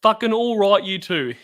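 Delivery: real exasperation with a grin in it; exasperated. Real, not acted. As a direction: throw it at the two teasers, mock-annoyed. Exasperated at the teasing pair.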